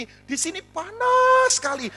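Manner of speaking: PANAS held long, high and loud; hammed-up wail on "panas", drawn right out; exaggerated heat complaint. Widow reads email, heat line exaggerated.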